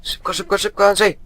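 high frantic urging; urgent hurry. Real, not acted. Rushing past monster. (wysoko, w pośpiechu, ponaglająco)

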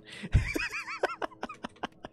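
a rising high burst of laughter; high squealing laugh. Real, not acted. Laughing at chat comment.

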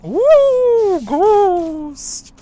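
very high ghostly hoot; spooky ghost woo. Pretend. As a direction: wail like a cartoon ghost.

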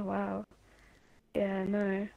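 usual speaking voice

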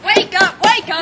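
loud wake-up. Acted. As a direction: rouse her, loudly.

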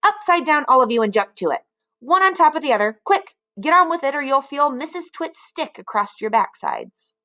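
high, barking orders; bossy monkey trainer. Acted. Mister Twit ordering monkeys to stand upside down, bossy. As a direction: drill the monkeys like a harsh trainer.

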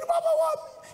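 put-on very high, overjoyed drunken greeting; drunk man. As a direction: imitate a drunk man crying out in a squeal. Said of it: "He was drunk, of course".

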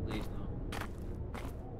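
breathy whisper; whispered plea. Real, not acted. Gamer begging the game, whispering. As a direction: barely breathe it.

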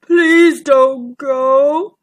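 slow, mournful, drawn-out plea; loud, woeful giant dinosaur; sad giant voice. A narrator's reading. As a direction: plead like a big sad creature. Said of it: "they heard a deep, sad voice".

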